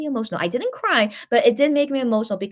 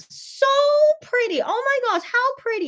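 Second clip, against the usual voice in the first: gushing. Real, not acted. Gushing over the photo.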